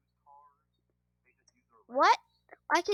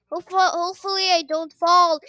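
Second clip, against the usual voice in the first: normal talking voice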